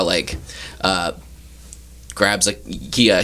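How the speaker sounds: ordinary delivery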